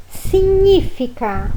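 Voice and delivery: significa with gn as nh, siɲifika; Italian pronunciation. Shown as a demonstration. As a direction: stress the nh in significa. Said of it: "a pronúncia é".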